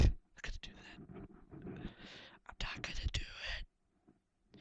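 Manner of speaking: breathy whispered aside. Real, not acted. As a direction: drop to a whisper. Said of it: "whispered aside after the demo".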